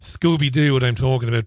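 usual speaking voice

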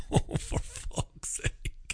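slow, whispered groan of disbelief; exasperated whisper. Real, not acted. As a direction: breathe it out, fed up. Exasperated aside after a rant.

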